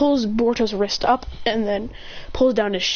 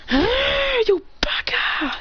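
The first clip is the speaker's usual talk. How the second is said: a high cry of "you baka!", stretched out slow; mock yell; 13 semitones higher, much slower than usual. Kid retelling a fight, crying out "baka" at the rival. Character yells insult in fight.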